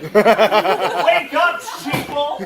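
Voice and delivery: hammed-up wake-up call to the masses; mock shout. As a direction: bellow it like a tinfoil-hat ranter, half laughing. Mock conspiracy rant.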